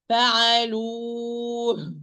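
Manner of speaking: slow "فعلوه", long oo released into h; drawn-out stop on an airy ه; audible final ha. Shown as a demonstration. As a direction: hold the vowel of "فعلوه", then let the ه be heard. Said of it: "I must hear the ha".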